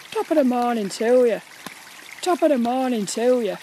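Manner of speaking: stagey Irish greeting; hammed-up Irish accent. Shown as a demonstration. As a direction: put on a stock Irish brogue. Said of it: "the Irish".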